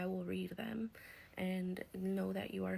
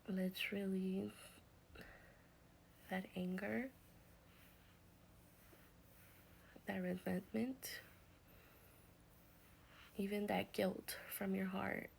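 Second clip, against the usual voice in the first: guided healing meditation; 6 dB quieter than usual. Acted. Healer guides grieving viewers to release anger, soft meditation voice. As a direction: ease them through the release, gently and softly.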